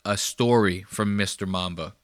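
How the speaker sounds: normal talking voice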